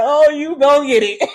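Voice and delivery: gleeful warning; laughing threat. Retold or imitated. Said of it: "I'm just like".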